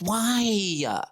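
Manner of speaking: put-on high, drawn-out childish protest; imitated whiny kid. Kid refused by mom, mock whining. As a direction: whine it like a kid told no.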